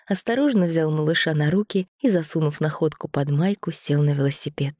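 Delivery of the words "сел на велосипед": plain reading voice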